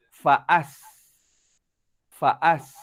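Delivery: faas with thin s, lips smiling; sin sound with smile. Shown as a demonstration. Teacher demonstrating faas with spread lips. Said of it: "kalau ini huruf sin".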